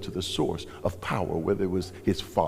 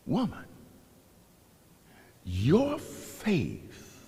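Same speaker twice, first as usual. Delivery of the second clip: slow tender blessing; much slower than usual. Acted. Jesus blessing the woman.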